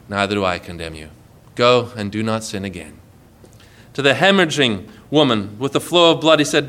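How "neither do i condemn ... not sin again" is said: very low, soft, forgiving voice; gentle absolution. Acted. Jesus forgiving the woman caught in adultery, gentle. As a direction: lower the voice and release them kindly.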